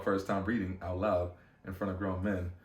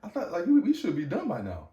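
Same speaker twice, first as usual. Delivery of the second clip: exasperated disbelief; 6 semitones higher than usual. Retold or imitated. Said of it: "I'm like".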